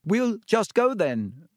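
high, drawn-out nervous call; desperate call. A narrator's reading. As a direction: call out desperately to get away. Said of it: "Harry called desperately".

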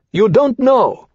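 high, heated character voice; snapped retort. A narrator's reading. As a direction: snap back at her, pitched high. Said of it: "Domain snapped".

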